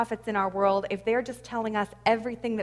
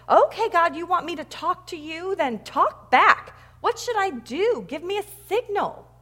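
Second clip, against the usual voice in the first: railing at God, high and raised; acted railing yell; 7 semitones higher, 6 dB louder than usual. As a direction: act out yelling at the heavens. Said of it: "yelling at him".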